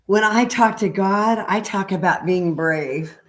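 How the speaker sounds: gentle sing-song picture book read; reading to children. Acted. Reads picture book aloud.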